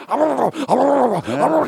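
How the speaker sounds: hammed-up caveman grunts while chewing; mock grunting. Man cramming lobster in the buffet line, mock grunting. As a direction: grunt like you're cramming food in.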